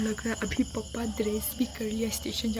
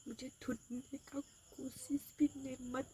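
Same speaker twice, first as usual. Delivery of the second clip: soft, hushed mock farewell to parents; quiet farewell; 3 semitones higher, 14 dB quieter than usual. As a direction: murmur it as if leaving for good. Staged runaway farewell message. (धीमी आवाज़ में नाटकीय विदाई)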